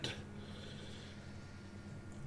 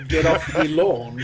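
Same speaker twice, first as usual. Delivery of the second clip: put-on louder echo of a thick British accent; mock British accent; 2 semitones higher, 5 dB louder than usual. Echoing a friend's funny comment, mock British accent. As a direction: copy the hammed-up British accent back.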